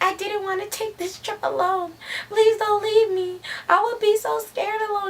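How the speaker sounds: high, whiny mock-crying voice; mock tearful plea; imitated crying. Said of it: "So she starts crying".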